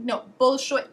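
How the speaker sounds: ordinary talk, nothing marked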